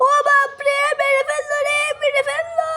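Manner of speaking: high, loud cry of pain; pained yelp. Acted. Father yelping after his foot is stepped on. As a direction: howl as if your foot was crushed.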